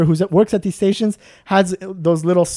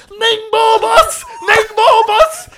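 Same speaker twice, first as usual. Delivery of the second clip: much higher, slow, laughing yell of Ningbo bus; mock scream; 5 dB louder, much slower than usual. Worker screaming outside the washroom, high laughing yell. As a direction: holler it from outside the door, cracking up. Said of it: "hear him screaming from outside".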